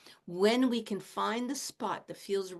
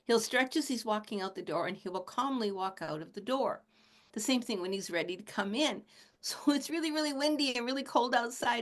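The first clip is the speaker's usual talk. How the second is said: ordinary talk, nothing marked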